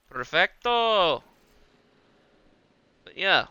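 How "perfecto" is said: Perfecto stretched slow and higher, savoured; a slow, savouring Perfecto; drawn-out mock Perfecto. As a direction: Perfecto pulled out long with a flourish.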